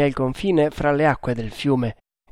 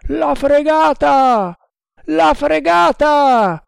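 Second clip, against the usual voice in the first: high, drawn-out cries; yelling; 13 semitones higher, 7 dB louder, much slower than usual. A narrator's reading. The Indians sighting the frigate, yelling. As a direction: yell it long, like a whole crew. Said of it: "urlarono gli indiani".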